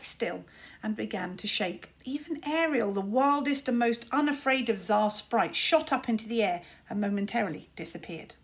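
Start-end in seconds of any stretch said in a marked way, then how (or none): none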